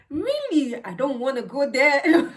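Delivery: high, laughing mock dismay; shocked laughing. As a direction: squeal it out, half laughing. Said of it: "I'm like".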